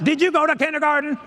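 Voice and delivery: high-pitched, indignant retold outburst; indignant challenge. Teacher confronts someone who cut in the airport line, indignant. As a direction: challenge a line-cutter, half-playing the outrage.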